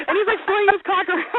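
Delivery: high, helpless laughing voice; cracking up. Real, not acted. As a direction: squeeze the words out through laughter. Cracking up at own image.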